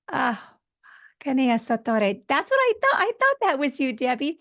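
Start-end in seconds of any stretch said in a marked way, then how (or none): 2.3-4.4 happy surprise. Real, not acted. Recognizing Debbie.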